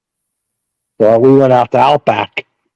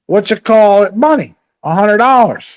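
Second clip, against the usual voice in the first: higher mock voice of a sister asking for money; mimicking sister; 10 semitones higher, 4 dB louder than usual. Teller mimics half sister begging for cash, higher mock voice. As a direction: ham up the sister's sweet ask. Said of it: "next time I talk to her".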